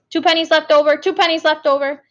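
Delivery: insist, as if arguing over math; insistent. Acted. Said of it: "like someone is arguing with her math".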